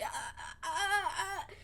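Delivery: nervous laughing stammer; breathy hesitant uh uh; flustered stammer. Retold or imitated. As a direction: stammer like a panicked kid. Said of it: "and he's like".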